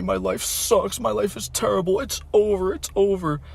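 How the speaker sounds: imitated crying. Friend crying on his Instagram story, mock sob. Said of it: "He was crying, like, literally crying".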